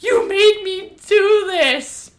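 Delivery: high, slow dramatic accusation; mock accusation. Acts girl blaming the others.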